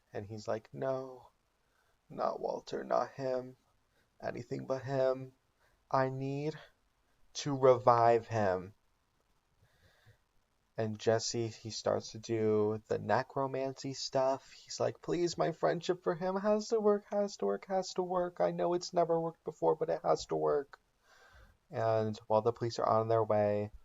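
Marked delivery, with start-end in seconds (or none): 0.7-8.7 high, pleading imitation of a crying Jesse; mock crying plea. 15.0-20.8 high, frantic, repeated begging; will the spell to work, voice pitched up; desperate pleading. Retold or imitated. Jesse attempting desperate necromancy.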